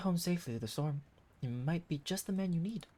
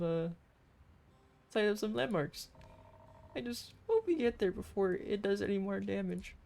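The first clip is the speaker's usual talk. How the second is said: normal talking voice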